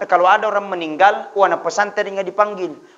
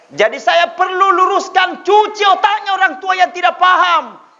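raised, driving sermon voice; fiery preaching; 9 semitones higher than usual. Acted. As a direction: hammer the point home to the crowd. Preaching at full force.

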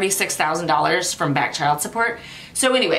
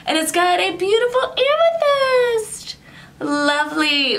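higher, slow, dreamy delight; 10 semitones higher, slower than usual. Real, not acted. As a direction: swoon over the pretty crystal. Delighted gasp at the item.

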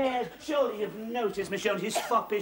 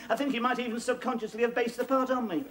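ordinary delivery

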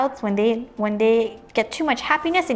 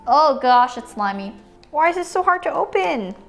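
normal talking voice

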